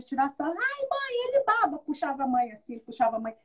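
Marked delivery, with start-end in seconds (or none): 0.5-1.8 put-on high-pitched tattling child voice; squeaky-high mock child teasing; mocking little girl. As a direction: imitate a little girl complaining to mom. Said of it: "a menininha começou a dar risada".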